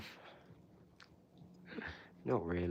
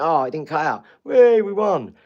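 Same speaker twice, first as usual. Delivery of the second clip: mock celebration; 5 semitones higher, 9 dB louder than usual. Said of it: "We think".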